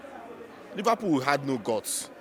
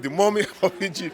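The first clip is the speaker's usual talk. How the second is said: laughing; 5 semitones higher than usual. Real, not acted. Jokes about Salah as mummy.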